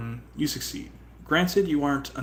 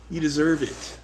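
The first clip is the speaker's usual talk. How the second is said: usual speaking voice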